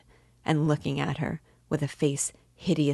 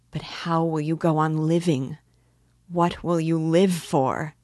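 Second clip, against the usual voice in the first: urgent question, building louder; distressed cry; 4 dB louder than usual. A narrator's reading. Sonia asking how he will live, in a distressed cry. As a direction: cry out, slowing on the last question. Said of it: "cried Sonia".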